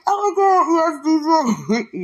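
high, slow, loud emotional cry; excited cry. Retold or imitated. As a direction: cry out yes with joy, stretched. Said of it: "I was like".

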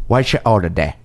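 a hint of Cockney, low and measured; lightly voiced English film star; Michael Caine voice. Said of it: "your Michael Caine".

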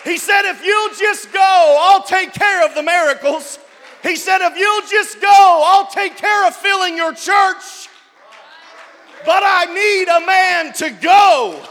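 rising shouted sermon refrain; preaching at full force. Acted. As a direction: build and hammer it home like a revival preacher. Preacher building to climax.

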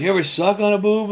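put-on boys bragging. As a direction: ask it like a swaggering boy. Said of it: "You know".

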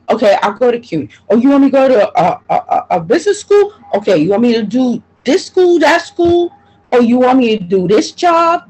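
high, fast, running list of demands; fed-up mother mimicking all she is told to do; exasperated listing. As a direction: rattle off every demand, fed up. Mocking the demands put on her.

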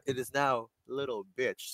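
hushed, deadpan clerk; quiet official note; cashier signing it down. Acted. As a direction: say it very softly, as a formal entry. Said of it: "as the person at the cashier is signing it down".

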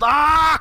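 loud, high scream imitating the panicked son; mock a kid flipping out with a yell; freak-out scream. Podcaster retelling the son flipping out, loud mock scream. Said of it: "the fucking son was flipping out".

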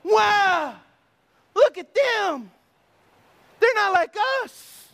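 high, loud amazement; acted, high-pitched admiring exclamations; impressed onlookers. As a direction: marvel aloud like an awed onlooker. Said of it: "people go around going".

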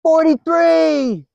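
high, slow drawn-out shout; prisoner shouting. Retold or imitated. Prisoner shouts a joke number that falls flat, slow and high. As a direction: belt the number out across the room. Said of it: "another prisoner yells".

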